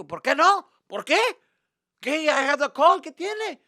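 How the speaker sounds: loud, high-pitched challenge; indignant dad. Retold or imitated. Dad demanding why they can't be here, indignant. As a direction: fire back questions as an outraged dad. Dad argues back about being there.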